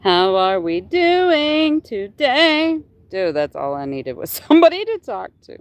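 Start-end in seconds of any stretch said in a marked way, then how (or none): 0.0-2.8 bright greeting. Real, not acted. Greeting live chat viewers.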